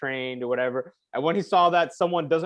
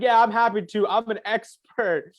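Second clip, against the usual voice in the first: animated; 8 semitones higher, 4 dB louder than usual. Retold or imitated. Host asserting his expertise to the guest, retold as animated. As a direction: imitate an agitated, performing expert. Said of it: "like, animated".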